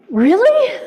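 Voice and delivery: delighted disbelief; laughing amazed really. Shown as a demonstration. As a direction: giggly wonder. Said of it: "We're not alone in this".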